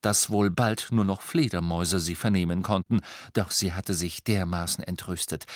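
ordinary delivery

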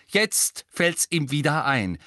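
slow, loud, sarcastic jab; hissing snap. A narrator's reading. Angelina mocks Harry's memory, snapping. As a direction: spit the words at him. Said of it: "fauchte Angelina".

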